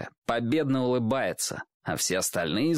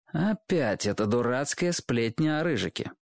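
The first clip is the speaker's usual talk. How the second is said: ordinary delivery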